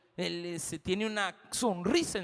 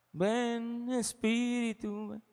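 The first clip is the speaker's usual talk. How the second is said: slow, drawn-out call to the Spirit; lightly voiced worshipper begging the Spirit to come; worshipper's plea; 5 semitones higher, much slower than usual. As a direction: stretch out each word as a pleading worshipper.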